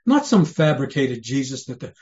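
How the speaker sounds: normal talking voice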